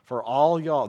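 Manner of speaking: All y'all said in a loud Southern drawl. Retold or imitated. Preacher quoting a friend, loud Southern drawl. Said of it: "this is the southern version".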